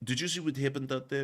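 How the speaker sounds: hushed, cold boss questioning a subordinate; quiet interrogation. Retold or imitated. Russian officer confronting the air defense chief.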